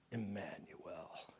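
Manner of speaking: slow, breathless whisper of the name; muttered to himself. A narrator's reading. Satan reading the name in the book, whispered mutter. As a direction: whisper it as if afraid to say it. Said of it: "He muttered it to himself".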